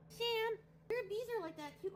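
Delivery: high, bright call to the pet; excited call. Real, not acted. Calling to Sam across the room, high and excited.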